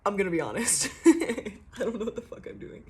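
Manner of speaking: giggly, owning up to cluelessness; laughing confession. Acted. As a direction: admit it through a laugh.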